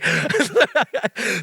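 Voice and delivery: high nervous burst of laughter. Real, not acted. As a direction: laugh it off, embarrassed. Nervous about names, laughs.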